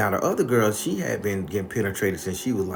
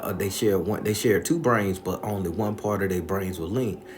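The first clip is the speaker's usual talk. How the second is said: usual speaking voice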